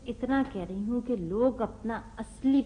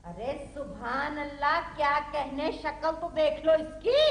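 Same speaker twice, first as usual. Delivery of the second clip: higher-pitched sarcastic echo of admiration; mocking praise; 4 semitones higher than usual. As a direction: throw the applause back scornfully. Mother mocks father's praise of son.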